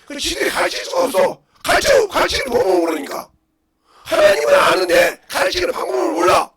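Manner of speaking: loud, high-pitched, impassioned sermon; full-force preaching. Real, not acted. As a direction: shout it out to the congregation.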